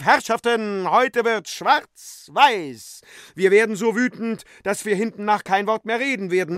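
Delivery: high, loud proclamation to the crowd; calling out. A narrator's reading. Euphemia calls to the company from above, loudly proclaiming. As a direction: cry it out like a showman, drawing out the key words. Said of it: "und rief".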